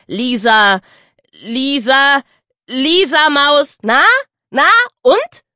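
high, loud mocking girl's voice; rising jeer from a young girl; teasing girl. A narrator's reading. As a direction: egg him on like a cheeky kid. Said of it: "neckte ihn das freche Gür".

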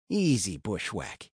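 soft, slow calming voice; soothing. A narrator's reading. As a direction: hush him gently. Calming the dying deputy.

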